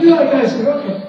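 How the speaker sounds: eager owner. Retold or imitated. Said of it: "He's like".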